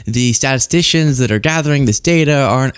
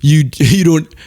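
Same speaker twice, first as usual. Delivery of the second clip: put-on Doctor Phil accent; 3 semitones lower than usual. Comic lecturing the anchor in a mock Doctor Phil accent. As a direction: lean in like a folksy TV therapist, Doctor Phil accent. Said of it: "I don't know his accent".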